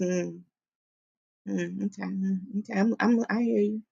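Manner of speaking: soft, noncommittal mm okay, barely voiced; quiet murmur. Daughter giving her father a quiet, guarded reply. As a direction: murmur it back to him without giving in. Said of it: "I just".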